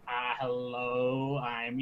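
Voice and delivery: slow, overly careful hello; mock slow phone greeting. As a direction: stretch each word for a foreign listener. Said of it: "I gotta talk slower".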